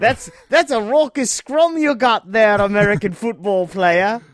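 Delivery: mock British.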